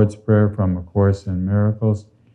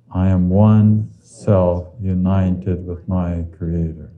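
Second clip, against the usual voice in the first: slowly; slower than usual. Shown as a demonstration. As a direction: let each word land slowly. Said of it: "Tell yourself again slowly".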